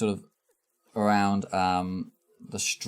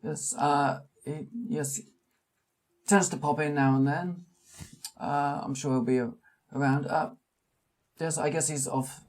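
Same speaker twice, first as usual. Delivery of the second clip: put-on small old man voice; 7 semitones higher than usual. GM voices Charles Adams.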